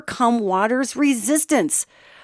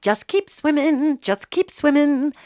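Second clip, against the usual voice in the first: higher and slow, coaxing each word out; drawn-out higher cartoon-fish quote; slow, sing-song higher Dory; 6 semitones higher than usual. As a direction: lift it higher and stretch it out like Dory. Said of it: "I'm gonna quote a Pixar movie".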